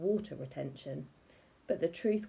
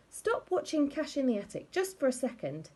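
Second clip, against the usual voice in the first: exasperated plea; 7 semitones higher than usual. A narrator's reading. Husband pleading with wife.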